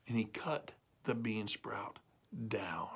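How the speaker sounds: dropped to a whisper; hushed. A narrator's reading. Narrator hushing as the beanstalk is cut. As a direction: let it fall away into a hush.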